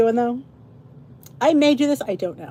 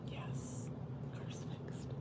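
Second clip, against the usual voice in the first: whispered repeat; 25 dB quieter, slower than usual. Real, not acted.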